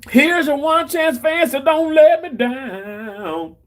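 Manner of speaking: quoting it up high and slow; high, slow sing-song take. Retold or imitated. Reading a chat line in a high, stretched sing-song. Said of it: "I said".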